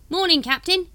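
high, loud cockney-ish hawker; street hawker voice. Acted. Dibbler greets the Captain, high hawker voice. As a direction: sidle up like a spiv.

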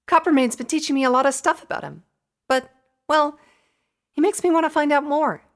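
very high, loud pony voice; high-pitched chatty pony; Pinky, earnest. A narrator's reading. Narrator voices Pinky explaining.

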